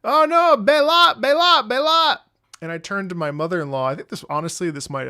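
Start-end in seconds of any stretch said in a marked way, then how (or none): 0.0-2.2 high, loud mock chant of alarm; excited young men. Streamer imitating young Filipino guys teasing a traveller, loud chant. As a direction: imitate guys yelling a taunt. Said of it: "young Filipino guys were like".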